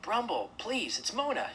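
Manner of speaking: high, pleading call; loudest voice. A narrator's reading. Mona cries to the bear a third time, high pleading call. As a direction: call out high, begging to be heard. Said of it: "in her loudest voice".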